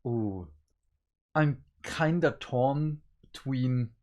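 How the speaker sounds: ordinary talk, nothing marked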